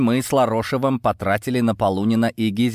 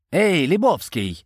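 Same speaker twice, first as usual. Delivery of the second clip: calling out; 8 semitones higher, slower than usual. A narrator's reading. Борис calling the hidden eavesdropper, calling out. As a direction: call toward someone hidden in the bushes. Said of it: "ещё раз крикнул Борис".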